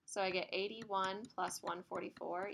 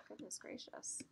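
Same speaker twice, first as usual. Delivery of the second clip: whispered aside; 2 semitones higher, 10 dB quieter, slower than usual. Real, not acted. Muttered aside at the board.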